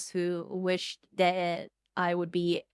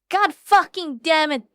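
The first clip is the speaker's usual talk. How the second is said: raised, loud exasperation; exasperated cursing; 6 semitones higher, 6 dB louder than usual. Real, not acted. Streamer finds out Benga told Boshi, exasperated outburst. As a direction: curse loudly at the culprit.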